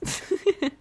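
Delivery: a quick high laugh, amused at herself; short bright laugh. Real, not acted. Laughing at her own scooter story, a quick high laugh. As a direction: break into a little real laugh.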